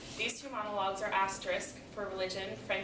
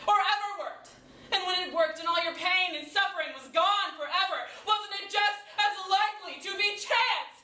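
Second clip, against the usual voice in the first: building from pointed to high, loud outrage; escalating challenge to God; indignant questioning; 8 semitones higher, 8 dB louder than usual. Acted. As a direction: argue with mounting frustration. Monologue, building rage at unanswered prayer.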